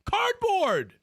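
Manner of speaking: high, indignant exclamation; incredulous outburst. Real, not acted. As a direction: cry it out in outraged disbelief. Indignant repeat of what was sold.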